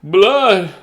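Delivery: loud, disgusted cry; yelled. Acted. Father seeing the fork in his son's finger, loud yell. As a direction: shout it, grossed out by the blood. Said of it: "Loretta's father yelled".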